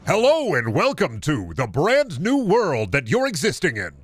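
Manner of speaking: lightly performed host read; performance voice. Shown as a demonstration. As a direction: welcome the audience like a show host. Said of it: "cadence of performance without going too deep".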